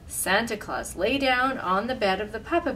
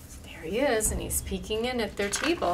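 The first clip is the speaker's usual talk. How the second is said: ordinary delivery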